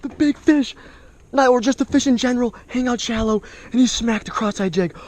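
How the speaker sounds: high, loud real whooping amazement; heart-pounding excitement. Real, not acted. Angler landing a huge fish, freaking out with excitement. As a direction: burst out loud and high, overwhelmed by the catch. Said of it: "my heart is beating so fast".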